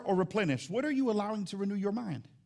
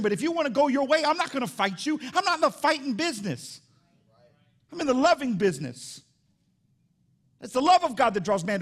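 higher-pitched preacher voicing God; impassioned preaching; 7 semitones higher, 6 dB louder than usual. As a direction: preach it with raised pitch and firm insistence. Preaches in God's voice, raised.

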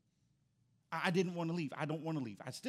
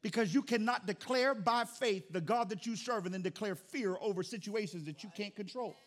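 ordinary delivery

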